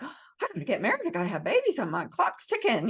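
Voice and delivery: imitated panic, rushed and starting high; mock a frantic fretter in a hurry; freaking out. Coach imitating a young client fretting about marriage and babies, mock panicked. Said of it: "she's, like, freaking out".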